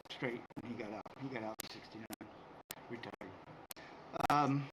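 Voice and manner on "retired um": ordinary talk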